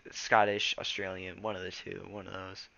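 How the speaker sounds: ordinary delivery, nothing marked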